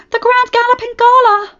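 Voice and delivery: very high, loud dramatic cry; dramatic exclamation. Acted. Rarity crying out about the gala, very high and loud. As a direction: announce the disaster with full drama. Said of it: "Rarity exclaimed".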